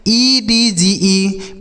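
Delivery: letters spelled out slowly one by one; deliberate teacher spelling. Shown as a demonstration. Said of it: "अगला नियम देखिए".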